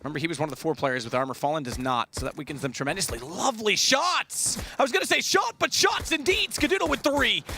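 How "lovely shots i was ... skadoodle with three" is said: excited. Real, not acted. Caster delights at shots.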